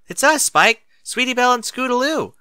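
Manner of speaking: loud, high call through the door. Acted. Fillies call to Spike through the door, loud call. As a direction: shout to be let in.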